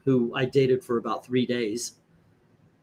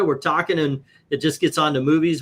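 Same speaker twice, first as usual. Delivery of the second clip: normal talking voice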